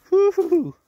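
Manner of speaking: high, loud whooping cheer; excited whoop. Real, not acted. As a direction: whoop with delight. Whoops after the shot.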